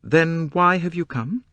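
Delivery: high, pointed demand; challenging woman's question; abrupt question. A narrator's reading. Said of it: "she asked abruptly".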